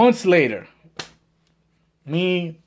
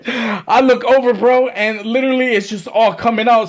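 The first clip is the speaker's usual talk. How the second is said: excited; 6 semitones higher, 4 dB louder than usual. Real, not acted. Friend vomiting in the car.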